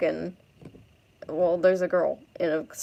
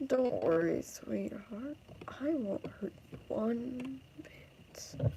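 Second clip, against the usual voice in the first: soft, slowing creepy whisper; menacing creep; 3 semitones higher, 10 dB quieter, slower than usual. Acted. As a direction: sink into a sinister, falsely soothing whisper. Drunk creep closing on girl.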